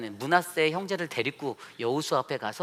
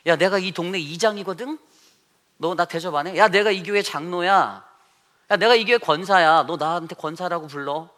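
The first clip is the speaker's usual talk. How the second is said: loud, higher self-important demands; arrogant bossing; 4 semitones higher, 8 dB louder than usual. Retold or imitated. Church elder demanding respect from others, loud and arrogant. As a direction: throw your rank around at a nobody. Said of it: "이러면서 다니는 거예요".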